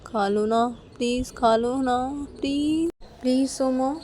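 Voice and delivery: acted pleading apology. Character begs angry partner to forgive and eat, pleading. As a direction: beg with stretched words, rising in pitch.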